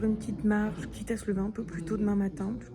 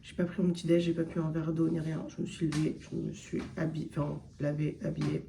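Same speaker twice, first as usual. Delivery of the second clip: low, soft vocal fry morning voice; just-woke-up vocal fry, lower and softer; 5 semitones lower, 5 dB quieter than usual. Real, not acted. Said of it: "c'est ma voix du réveil aussi". (voix du réveil, grave et éraillée)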